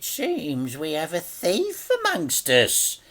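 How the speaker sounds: a smug gangster savouring the moment; Mister Big, menacing. A narrator's reading. Mister Big's voice.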